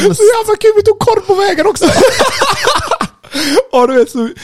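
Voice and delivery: very high, loud, laughing delivery; laughing hard. Real, not acted. Storyteller delighted by his own walk of shame, cracking up. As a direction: squeal it through real laughter.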